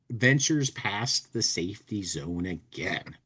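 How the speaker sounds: usual speaking voice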